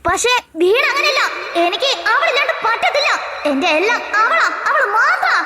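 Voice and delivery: usual speaking voice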